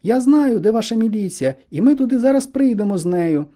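put-on high, loud, determined young girl; ringing high girlish voice; excited girl calling out. A narrator's reading. Girl promises help, calls to friend.

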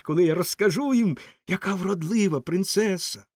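high, excited fireball voice; thrilled high-pitched chatter; joyful exclamation. A narrator's reading. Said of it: "вигукнула вогняна куля".

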